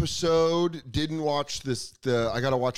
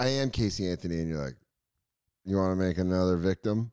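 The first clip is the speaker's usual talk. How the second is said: deadpan bar exchange; 8 semitones lower than usual. Retold or imitated. Casey Anthony answering a drunk guy at the bar, low deadpan. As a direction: play it low and dry.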